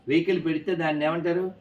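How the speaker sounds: usual speaking voice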